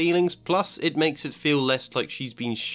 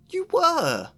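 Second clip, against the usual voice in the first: sharp schoolgirl retort; 4 dB louder than usual. Acted. Hermione retorts very high.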